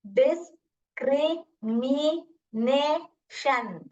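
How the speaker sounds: very slow डिस-क्रि-मि-ने-शन with gaps; chin-drop syllable count on discrimination. Shown as a demonstration. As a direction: chop discrimination into five separate beats. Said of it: "आप चिन drop करके देखिए".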